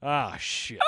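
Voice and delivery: ordinary talk, nothing marked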